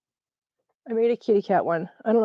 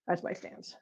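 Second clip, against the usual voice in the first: whisper; 16 dB quieter, slower than usual. Real, not acted. Whispered aside to herself.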